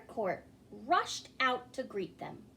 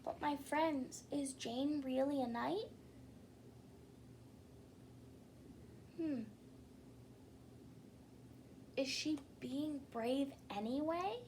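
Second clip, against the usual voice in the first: put-on soft, musing question to the children; quiet wondering aside to young listeners; storyteller aside; 3 semitones higher, 9 dB quieter than usual. A narrator's reading. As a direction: lean in and ask the kids softly. Narrator asks listeners aside.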